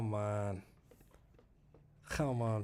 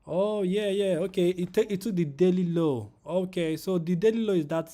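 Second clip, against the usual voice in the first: sudden loud, high burst of excitement; real live thrill, much louder and higher; excited outburst; 7 semitones higher, 11 dB louder than usual. Real, not acted. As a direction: blurt it out as the chart moves. Price hits daily low live.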